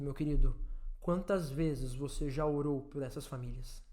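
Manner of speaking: low, hushed, halting gravity; solemn preaching. Real, not acted. Preacher mourns pandemic deaths before the congregation, hushed solemn preaching. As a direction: let each phrase drop softly, with heavy pauses.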